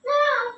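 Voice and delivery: one high-pitched imitated bird call; peacock call. Peacock's call between speeches.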